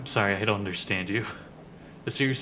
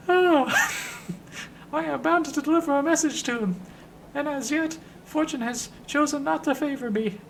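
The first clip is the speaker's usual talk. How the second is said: Ben the Sender. Acted. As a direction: sigh deeply, complain in a high voice. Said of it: "Ben gives a deep sigh".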